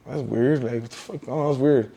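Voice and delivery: low, soft self-talk, fading away; puzzled aside. Retold or imitated. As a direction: murmur it to yourself, puzzled. Retelling his puzzled thought to himself.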